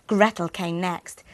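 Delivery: usual speaking voice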